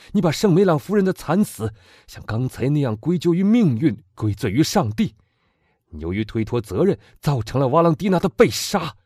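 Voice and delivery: put-on loud, high, fervent young man; impassioned young man. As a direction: demand revenge with burning passion. Morrel's accusation. (激昂的青年)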